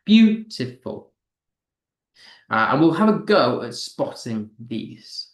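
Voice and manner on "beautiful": "Beautiful" slowly, first syllable high, the rest falling away; "Beautiful" as strong-weak-weak; stressed first syllable. Shown as a demonstration. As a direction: punch the start of "Beautiful", two weak beats after. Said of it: "stressed, unstressed, unstressed".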